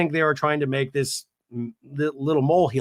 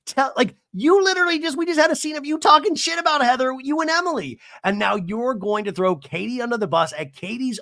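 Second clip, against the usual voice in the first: high-pitched, indignant disbelief; incredulous rant; 9 semitones higher than usual. Real, not acted. Host calling out Tamra's hypocrisy, incredulous rant. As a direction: demand an explanation, voice pitched way up.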